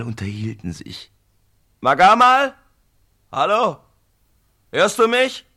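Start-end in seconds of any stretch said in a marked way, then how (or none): 1.8-5.4 loud, high call into the distance. Acted. Mafaka calls out to his brother Magamal, loud. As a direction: call out to reach him.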